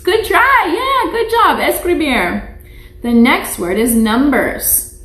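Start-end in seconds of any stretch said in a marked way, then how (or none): 0.0-1.6 excited praise. Real, not acted. Praising a child's attempt.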